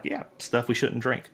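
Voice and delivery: normal talking voice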